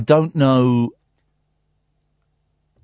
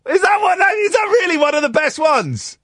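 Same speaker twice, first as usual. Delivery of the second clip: incredulous shout; 4 dB louder than usual. Real, not acted. Host mocking caller's joke.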